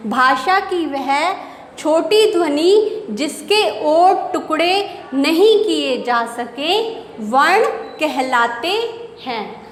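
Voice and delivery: slow, measured dictation pace; teacher dictating a definition slowly. Shown as a demonstration. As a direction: spell out the definition word by word for the class. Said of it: "teacher dictating definition slowly".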